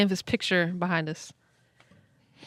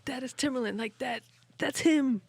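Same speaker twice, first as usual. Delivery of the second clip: hushed and higher, breathless awe at seeing his idol; awed hushed gasp; 6 semitones higher, 7 dB quieter than usual. Retold or imitated. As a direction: whisper it like you can't believe who just walked in. Spotting idol arriving, retold.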